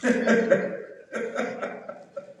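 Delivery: a long breathy laugh. Real, not acted. Preacher laughing at the twelve-hour reading, breathy laugh.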